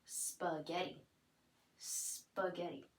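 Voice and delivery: spaghetti slowly and softly, syllable by syllable; slow clear model. Shown as a demonstration. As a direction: stretch out spaghetti for the child to hear.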